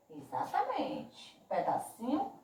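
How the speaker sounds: ordinary talk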